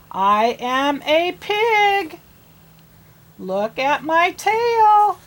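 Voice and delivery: a pig proudly showing its tail. Acted.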